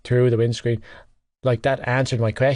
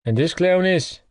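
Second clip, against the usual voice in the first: derisive; 6 semitones higher than usual. Acted. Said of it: "tone derisive".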